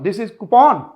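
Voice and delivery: normal talking voice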